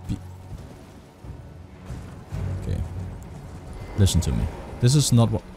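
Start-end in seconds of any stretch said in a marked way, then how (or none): none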